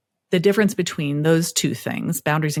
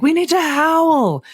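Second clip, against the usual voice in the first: high, slow, louder rallying cry; rallying call; 11 semitones higher, 5 dB louder, slower than usual. Retold or imitated. Parent rallying the kids to howl, slow rallying call. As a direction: call the family to howl together. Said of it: "I'll be like".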